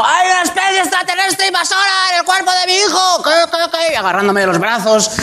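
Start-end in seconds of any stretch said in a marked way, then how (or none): 0.0-3.9 high, frantic mock alarm; panicked mother. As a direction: shriek like a terrified mum. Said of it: "mi madre llamando a la puerta al vecino". (madre aterrada que pide ayuda a gritos)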